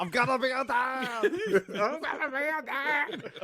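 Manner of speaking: high giggling shout; laughing squeal. Pretend.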